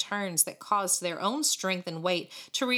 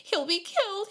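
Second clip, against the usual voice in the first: moaning; 13 semitones higher than usual. A narrator's reading. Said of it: "she moaned".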